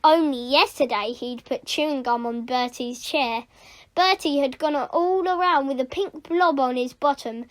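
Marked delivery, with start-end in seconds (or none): none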